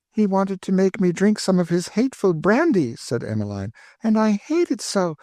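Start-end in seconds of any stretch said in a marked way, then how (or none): 0.1-3.0 put-on high, anxious girlish pleading; pleading young girl. Emmeline complains. 4.0-5.2 put-on high, anxious girlish pleading; pleading young girl. Emmeline complains.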